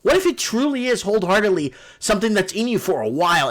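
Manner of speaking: ordinary delivery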